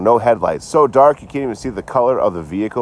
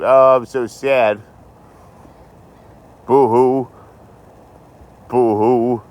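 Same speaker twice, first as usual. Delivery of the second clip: hammed-up fake boo-hoo, a little louder; mock crying; 3 semitones higher, 4 dB louder, much slower than usual. Streamer fakes sad crying to viewers for sympathy, mock boo-hoo. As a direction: pretend to sob so viewers pity you. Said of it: "Is it working?".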